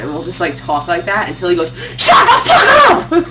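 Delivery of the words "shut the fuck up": much higher, louder mock yell, slowed; angry shout. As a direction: explode like a fed-up dad. Dad snapping at teasing.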